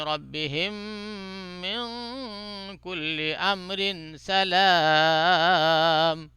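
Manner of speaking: slow, drawn-out melodic tajweed chant; Quran recitation. Shown as a demonstration. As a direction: chant each phrase slowly with long vowels. Recites second way.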